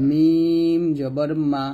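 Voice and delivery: মিম জবর মা said very slowly, with both lips pressed for মিম; the teacher shows students the lip sound, very slowly; slow lip drill. Shown as a demonstration. ঠোঁট দিয়ে ধীরে উচ্চারণ. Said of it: "দুই ঠোঁটের শুকনো অংশ থেকে উচ্চারণ করি".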